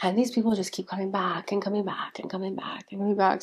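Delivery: unvoiced whisper; whispered aside about the trolls. Real, not acted. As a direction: hush it like a gossip aside. Scoffing aside about persistent commenters.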